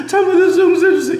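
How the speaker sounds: high, loud mock wail of repentance; tearful begging. David begs his father for forgiveness, acted tearful plea. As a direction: plead like a weeping child, hammed up. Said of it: "눈물 흘리고 마치 아버지한테".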